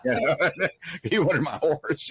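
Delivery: real laughter breaking into speech; laughing while talking. Real, not acted. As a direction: chuckle through the punchline of your own story. Laughing at his own horse story.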